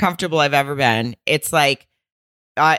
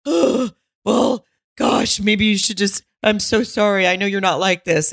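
higher-pitched, mock-sympathetic girlfriend; imitated apologetic girlfriend; 4 semitones higher than usual. Girlfriend forgiving her cheating boyfriend, imitated apologetic voice. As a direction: play her giving in with a gasp and an apology. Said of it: "and she's like".

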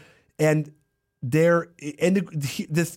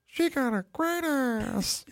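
put-on slow, high baby Pacino voice; 12 semitones higher, 6 dB quieter, slower than usual. Baby Pacino leering at babysitter.

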